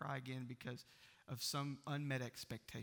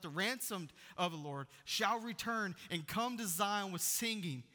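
scripture reading; 4 semitones higher than usual. Acted. Reads Isaiah with rising fervour.

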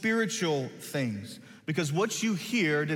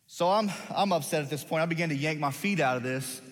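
normal talking voice

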